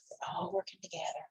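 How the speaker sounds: hushed aside; barely voiced, soft; whisper. Real, not acted. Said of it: "reader whispers aside".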